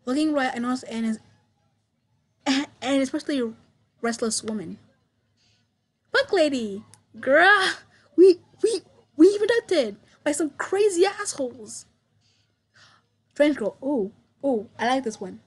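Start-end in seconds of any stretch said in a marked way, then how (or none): 6.1-11.8 loud, higher, panicky yelling; frantic shout. Acted. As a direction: scream at them in outrage. Restless woman yells at strangers.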